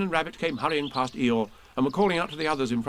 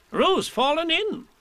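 higher, louder slow alarmed cry; cried out; 4 dB louder, slower than usual. Acted. Rabbit crying out that Roo fell in. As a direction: sound the alarm. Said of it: "cried Rabbit".

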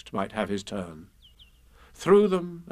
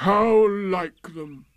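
very slow, higher doleful drone; Eeyore, bitterly gloomy; 7 semitones higher, 4 dB louder, much slower than usual. A narrator's reading. Donkey blaming others for his loss, slow and gloomy. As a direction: stretch out each word dejectedly. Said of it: "he added after a long silence".

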